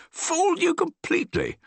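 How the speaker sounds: very high gleeful crow; triumphant glee. A narrator's reading. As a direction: gloat with delight. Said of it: "cried triumphantly".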